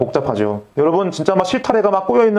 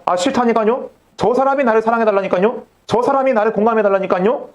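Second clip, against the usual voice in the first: higher-pitched mock protest; 따지는 거절; 6 semitones higher than usual. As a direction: push back insistently, acting out someone who refuses. 사랑을 거부하며 따지는 연기. (싫다고 높은 소리로 따지는 흉내)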